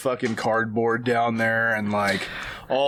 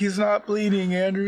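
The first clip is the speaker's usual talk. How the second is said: higher, exasperated mom; 7 semitones higher than usual. Acted. Mother dismissing her son, higher and exasperated. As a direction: snap back like a tired mother. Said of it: "she's like".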